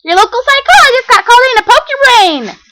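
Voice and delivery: very high, loud, bouncy voice; bubbly party pony. Acted. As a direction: chirp it like a hyper party pony. Pinkie's bubbly voice.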